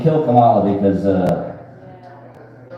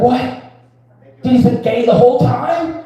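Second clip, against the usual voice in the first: high, stunned outburst; shocked disbelief; 10 semitones higher, slower than usual. Retold or imitated. Viewer stunned at the movie's ending, high shocked cry. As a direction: gasp it out like the twist just hit you. Movie twist reaction quote.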